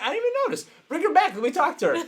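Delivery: high, quick eager pleading. Retold or imitated. Coach eagerly asking staff to bring her back.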